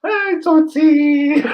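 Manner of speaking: very high, loud, drawn-out cooing call; high-pitched singsong greeting to a little kid; gushing greeter. Retold or imitated. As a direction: coo it loudly, as at a cute toddler. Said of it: "so he's like".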